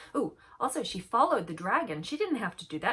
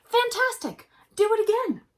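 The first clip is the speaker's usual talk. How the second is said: high, loud cheering shout; excited shout; 14 semitones higher, 10 dB louder than usual. Acted. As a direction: yell it to egg the dragon on. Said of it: "but Elizabeth shouted".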